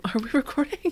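laughing as you ask; a laugh in the question. Real, not acted. Repeats question aloud, laughing.

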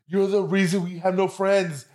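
mock rant, high and slow; brother going off. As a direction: imitate an exasperated brother going off, drawn out. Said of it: "it's just him going off".

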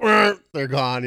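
mock frantic kids; a hint of kids yelling where are the treats; kids hunting treats. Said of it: "it was like".

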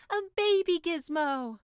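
put-on high, slow, loud delighted cry of A baby gizmo; excited cry. The mermaid thrilled by the sea witch's talk, a high delighted cry. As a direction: gasp it out like a wonderful discovery. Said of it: "cried the mermaid".

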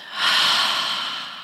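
drawn-out breathy release; showing how to exhale; long exhale sigh. Shown as a demonstration. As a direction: push the air out in a long sigh. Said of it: "and exhale out".

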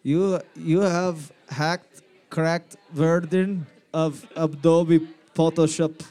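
slow, heavy fake Russian accent; mock Russian accent. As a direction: drag it out like a movie Russian hacker.

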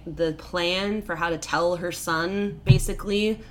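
ordinary talk, nothing marked

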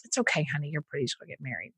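put-on older stranger, soft. Stranger on a plane soothes her, patronizing and soft. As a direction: soothe her like a condescending old man, quietly. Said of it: "And he's like".

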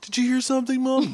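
high, mock-innocent question; a hammed-up parent pretending not to hear; mock oblivious parent. Parents ignoring Terry.

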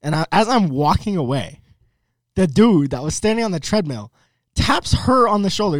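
ordinary talk, nothing marked

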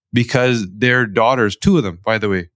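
ordinary talk

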